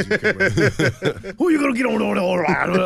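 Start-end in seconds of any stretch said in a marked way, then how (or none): 1.4-2.8 loud, high exaggerated New Orleans accent; mock New Orleans accent. Teasing a comedian friend about his New Orleans accent, loud mock accent. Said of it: "doing his New Orleans accent is one of the most preposterous things".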